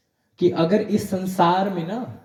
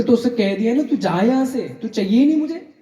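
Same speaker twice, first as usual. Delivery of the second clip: scolding shout; 7 semitones higher than usual. Acted. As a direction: shoo the boy off sharply. Old baba shoos the boy away.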